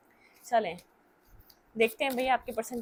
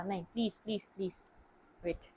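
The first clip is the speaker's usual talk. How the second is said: quiet pleading to herself; 2 semitones lower, 10 dB quieter than usual. Real, not acted. Pleading at the cards under breath.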